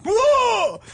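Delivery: high, loud scare noise; imitated monster roar. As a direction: leap out with a sudden mock-scary whoop. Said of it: "I went".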